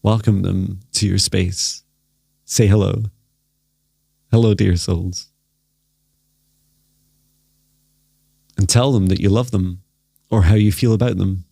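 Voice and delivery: guided meditation. Acted. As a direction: gently lead them into stillness.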